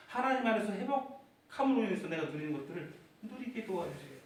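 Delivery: usual speaking voice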